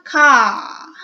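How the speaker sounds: long "a" sound demonstrated in "car"; long vowel demo. Shown as a demonstration.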